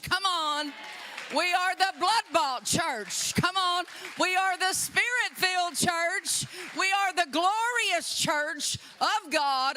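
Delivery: fired-up preacher, pitched high; preaching build. Real, not acted. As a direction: call it out like a rallying cry. Preacher building crowd, calling out.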